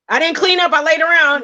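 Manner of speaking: high, anxious confession; worried. Retold or imitated. Wife retells her worry.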